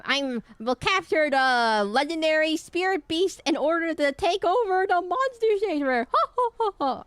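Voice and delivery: high, hammed-up villain boast; evil villain. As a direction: declaim it like a cartoon villain, ending in ho-ho laughter. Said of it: "That's what he sounded like".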